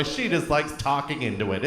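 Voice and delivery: normal talking voice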